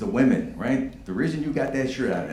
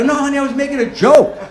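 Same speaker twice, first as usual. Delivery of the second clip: pleading husband; 10 dB louder than usual. Retold or imitated. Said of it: "I go".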